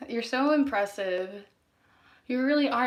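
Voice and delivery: normal talking voice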